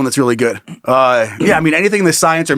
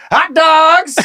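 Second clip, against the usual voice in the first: stadium vendor hawking; 12 semitones higher than usual. Pretend. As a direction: shout it across the stands. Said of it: "you can hear".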